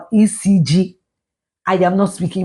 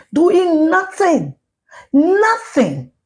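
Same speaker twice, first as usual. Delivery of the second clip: high, slow, stretched "doing nothing, nothing"; drawn-out scornful emphasis; 10 semitones higher, much slower than usual. Real, not acted. Vlogger mocking idle parliamentarians, drawn-out "nothing". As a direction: drag out "nothing" in disgust, pitched up.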